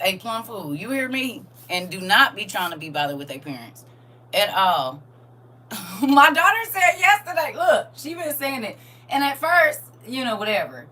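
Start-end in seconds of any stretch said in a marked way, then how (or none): none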